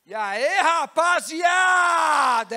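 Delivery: slow, drawn-out loud shout; big greeting shout. Acted. Servant greeting the party crowd with a huge shout. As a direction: call out to the whole room.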